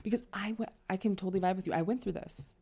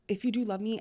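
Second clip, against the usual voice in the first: normal talking voice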